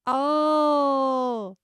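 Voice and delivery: long, loud, rising "Oh" as it clicks; big drawn-out "aha" moment; dawning realization. Real, not acted. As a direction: let the penny drop, drawn out and high. Suddenly gets the joke.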